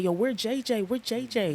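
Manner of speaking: mimicking his buddy asking around; light impression of a tipsy pal; Frank's voice. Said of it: "That's how Frank talk".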